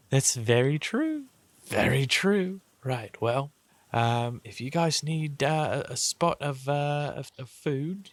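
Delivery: high, lilting, hesitant ladylike voice; gracious lady. Acted. Lady offering the travellers food, high lilting voice.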